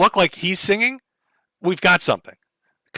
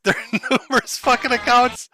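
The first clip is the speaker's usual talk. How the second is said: higher, laughing through the words; 6 semitones higher than usual. Real, not acted. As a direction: break up laughing as you say it. Amused by many song accounts.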